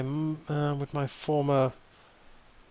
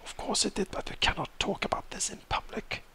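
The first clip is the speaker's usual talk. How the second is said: urgent-secret whisper, barely voiced; voice lowered further; 7 semitones higher, 6 dB quieter than usual. Zacharias warning Cash not to talk in public, whispering. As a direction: whisper so nobody else can hear. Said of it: "lowering his voice even more".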